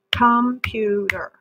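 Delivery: computer said slowly as com-pu-ter; broken into syllables. Shown as a demonstration. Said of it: "it helps to break it up like that".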